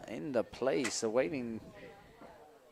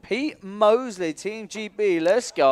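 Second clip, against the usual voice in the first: louder cheering build to let's go; excited hype; 6 semitones higher, 6 dB louder than usual. Real, not acted. Commentator hyping up the British rider, excited.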